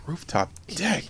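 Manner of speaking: breathless, unvoiced "Rooftop deck?"; hushed astonishment at the rooftop deck; amazed whisper. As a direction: whisper it in disbelief.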